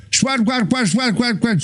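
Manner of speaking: fast mock American accent babble, no real words; mock American gibberish. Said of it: "Vorbesc și mult și repede și cu accent".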